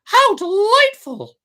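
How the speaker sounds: very high, bright-eyed puppet squeal of delight; high joyful puppet voice; excited puppet cry. A narrator's reading. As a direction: shriek it out like a thrilled wooden boy. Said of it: "cried Pinocchio".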